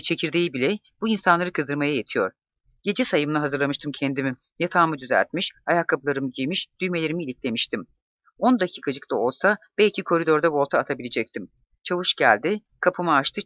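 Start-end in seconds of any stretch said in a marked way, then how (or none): none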